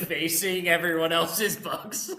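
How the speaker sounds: laughing. Real, not acted. Joking about signing others' books.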